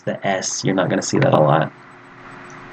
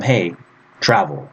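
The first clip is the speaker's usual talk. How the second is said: direct command; 2 semitones lower than usual. Shown as a demonstration. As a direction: tell them to do it, pitched down at the end. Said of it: "I want to tell him".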